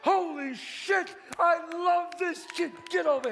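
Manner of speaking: high, loud, slowed triumphant yell; jubilant shout. Acted. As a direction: explode with joy at the win. Jubilant after winning throw.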